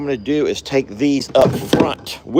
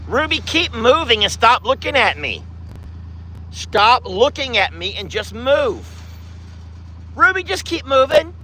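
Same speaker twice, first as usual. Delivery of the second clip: calling the cow, high and firm; 7 semitones higher than usual. Real, not acted. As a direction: shoo the cow along firmly. Shooing cows out of the way.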